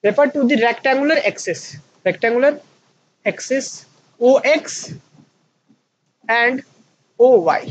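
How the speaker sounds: reading a definition aloud very slowly for students to copy; teacher dictating notes at a crawl; slow dictation. Shown as a demonstration. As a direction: dictate it word by word, spacing each phrase. Dictates textbook line.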